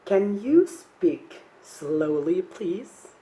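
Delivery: very slow, word by word, rising at the end; slow model phrase. Shown as a demonstration. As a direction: model it slowly for a class to repeat. Models slow speech for students.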